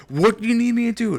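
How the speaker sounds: high, loud mocking voice of the helper; mock pushy helper. Mocking the helper who won't let go, loud and high. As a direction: squeak it out like an overeager busybody. Helper repeating himself again.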